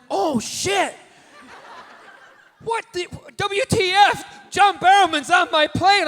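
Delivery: high, loud starstruck shout; a fan losing it at a celebrity. Retold or imitated. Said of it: "he went".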